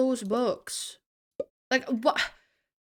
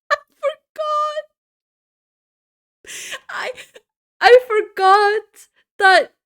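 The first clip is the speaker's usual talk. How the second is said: high squeal rising to loud wail; helpless dismay; 11 semitones higher, 8 dB louder than usual. Real, not acted. Streamer confessing to chat, high helpless wail. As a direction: squeal it, overcome by the mistake. Laughing dismay at own mistake.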